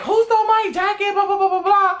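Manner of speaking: high-pitched indignant holler; shrill-pitched mock rant trailing into blah-blahs; mock accusing outburst. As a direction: put on a big accusatory fuss. Imagined accusing the party over jacket.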